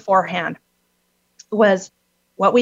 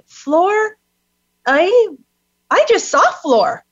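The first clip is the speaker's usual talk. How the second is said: higher, lightly voiced old farmer; a hint of a farmer remembering; lightly acted rugged farmer; farmer's surprise; 10 semitones higher, 3 dB louder, slower than usual. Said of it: "he's like".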